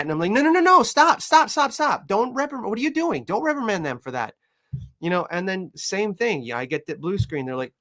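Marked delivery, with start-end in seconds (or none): 0.3-4.4 high, rushed no no no stop; urgent high protest. Retold or imitated. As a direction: jump in frantically to stop them. Trainer stops owners reprimanding.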